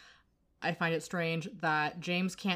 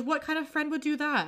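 high, mildly hammed-up reproach; mock indignant; 10 semitones higher than usual. She scolds her friend while trying not to smile, mock indignant. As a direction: protest in a high voice, holding back a smile. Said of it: "trying hard not to smile".

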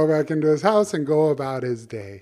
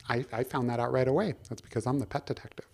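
quick smug aside; 5 dB quieter, faster than usual. Acted. Detective boasts in quick aside.

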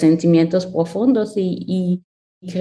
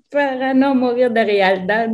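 higher voice with laughter in it; laughing; 4 semitones higher than usual. Real, not acted. Panelist answering why use imagination, laughing. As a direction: laugh your way through the words.

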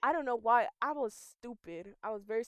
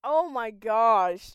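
surprised; 10 dB louder, much slower than usual. Real, not acted. Reacting to the anime summary.

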